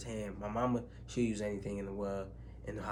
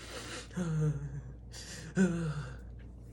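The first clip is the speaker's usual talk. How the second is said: long sigh; 5 semitones higher than usual. Real, not acted. Grief after his mother's news.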